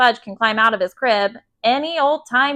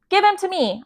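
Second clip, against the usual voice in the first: put-on high, urgent kid demand; higher-pitched, indignant insistence; cross demand; 8 semitones higher than usual. A narrator's reading. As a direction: order your little brother firmly. Angry boy orders little brother.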